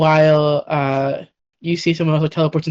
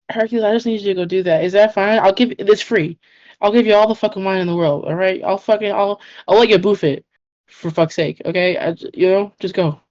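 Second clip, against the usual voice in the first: hurried pleading; 3 semitones higher than usual. Acted. Persephone begging Hercules hurriedly.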